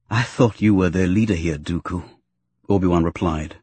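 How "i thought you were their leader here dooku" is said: controlled, low accusation; held steady. A narrator's reading. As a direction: keep the voice as steady as possible. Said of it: "holding his voice as steady as possible".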